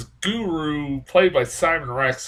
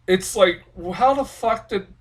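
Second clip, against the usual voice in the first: ordinary talk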